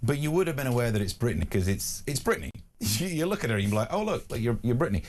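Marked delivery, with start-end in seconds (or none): none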